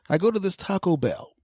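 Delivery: usual speaking voice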